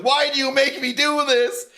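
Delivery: mock martyr's cry; self-flagellating man. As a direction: wail it in mock torment, starting to laugh. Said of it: "just imagine a man just fucking whipping himself".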